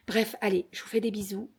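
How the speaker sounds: ordinary talk, nothing marked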